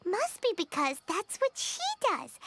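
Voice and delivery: ordinary talk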